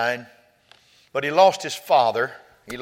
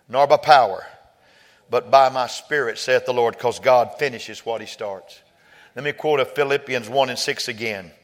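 ordinary talk, nothing marked

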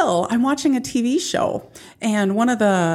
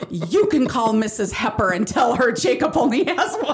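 mock angry kid; 5 semitones higher than usual. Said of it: "she was so mad".

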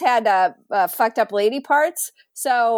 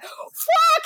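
a high, startled fuck; panicked curse. Retold or imitated. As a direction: caught in the act, blurt it out. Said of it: "I was like".

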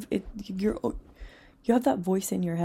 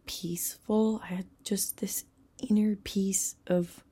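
hushed whisper. Real, not acted. Recalling peaceful moment on beach.

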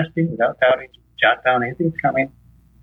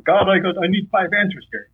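higher-pitched, demanding mock prayer; mock rushing in; 7 semitones higher than usual. Believer rushing in on God for answers, mock demanding. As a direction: burst in with an acted, impatient request. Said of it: "You don't rush in saying".

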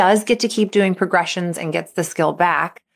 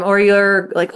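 normal talking voice

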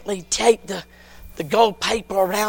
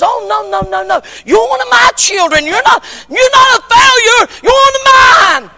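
much higher, loud, insistent reassurance; God reassuring; 14 dB louder than usual. Acted. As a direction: lift them up with a rising cry. God reassures the discouraged believer.

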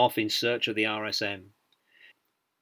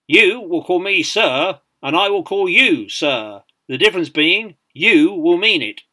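booming, measured drill-sergeant delivery; tremendous sergeant major voice; 6 semitones higher, 9 dB louder than usual. A narrator's reading. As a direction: address new recruits across a parade ground. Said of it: "Britain's tremendous voice".

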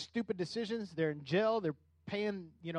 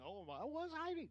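high, soft, timid little voice; sheepish Adam; 8 semitones higher, 11 dB quieter than usual. Retold or imitated. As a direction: mumble it like a caught child. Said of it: "And Adam's like".

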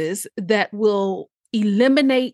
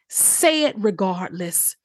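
ordinary talk

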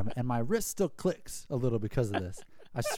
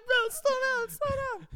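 squeaky, much higher frightened plea; terrified pleading. Retold or imitated. Scared kid on the speeding jet ski, high panicked pleading.

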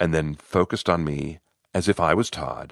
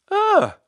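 short, high-pitched yelp of fright; sudden little shriek; little startled scream; 5 dB louder than usual. A narrator's reading. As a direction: let out a small high scream. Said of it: "He gave a little scream".

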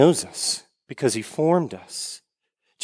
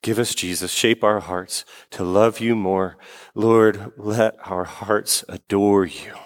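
prayer; 4 semitones lower than usual. Real, not acted. Closing prayer.

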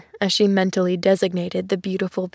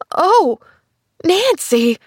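high, louder shocked outcry; horrified cry; 8 semitones higher, 5 dB louder, slower than usual. A narrator's reading. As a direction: cry out her name in horror. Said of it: "The burning horror".

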